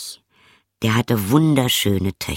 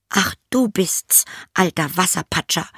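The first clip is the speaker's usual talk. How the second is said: high princess voice; storybook princess, pitched well above the narration; princess character; 9 semitones higher than usual. A narrator's reading. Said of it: "sagte sie".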